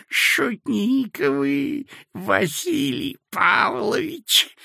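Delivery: put-on sweet-talking sinister old man; sly old man. A narrator's reading. Old clerk character voice. (вкрадчивый зловещий старичок)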